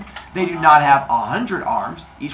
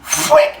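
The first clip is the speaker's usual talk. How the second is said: very high, louder mouth sound; stab sound effect; 6 dB louder than usual. Retold or imitated. Spear hitting Father Sky, high sound effect. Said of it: "it's like".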